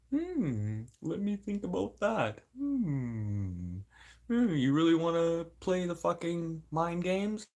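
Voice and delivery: high, drawn-out mock musing; mock pondering. Speaker toying with someone over mind games, mock pondering. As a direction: pretend to think it over, sliding down from high hums.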